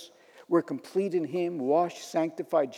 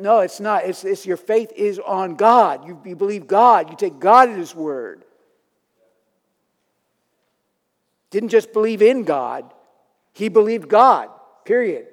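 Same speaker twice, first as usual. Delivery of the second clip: loud, higher, forceful insistence; emphatic preaching; 3 semitones higher, 9 dB louder than usual. Real, not acted. Preacher insisting to the congregation on believing God, loud and emphatic. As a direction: hammer each phrase home with raised voice. Preacher driving home his point.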